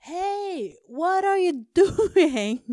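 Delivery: high, drawn-out holler in English; retold shout. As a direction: call out loudly, stretching every word. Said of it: "jeg ropte og sa sånn".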